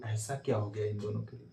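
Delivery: soft, low mock question from a student; voicing a puzzled pupil under his breath; imitated student. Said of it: "कहोगे". (धीमे से छात्र की नकल)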